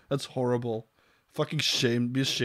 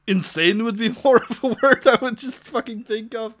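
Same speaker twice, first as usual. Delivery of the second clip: high, louder, laughing aside; laughing scoff; 9 semitones higher, 6 dB louder than usual. Real, not acted. Reader mocking a silly article, laughing scoff. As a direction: let laughter lift the voice high.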